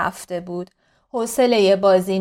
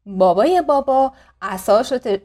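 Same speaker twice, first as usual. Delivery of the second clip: plain reading voice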